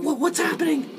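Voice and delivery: squeaky high bewilderment; a high alarmed squeak; high panicked question. Acted. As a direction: ask in sudden alarm. Panicked character.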